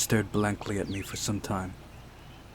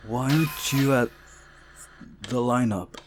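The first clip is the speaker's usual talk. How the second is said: mischievous; 4 semitones higher, 5 dB louder than usual. Acted. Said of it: "I asked mischievously".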